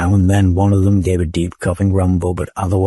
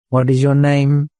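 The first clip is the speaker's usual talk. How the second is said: sharp snapped question; 6 semitones higher, 4 dB louder than usual. A narrator's reading. Eldest keeper snapping at the robot. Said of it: "the eldest snapped".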